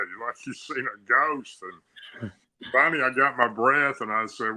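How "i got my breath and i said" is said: usual speaking voice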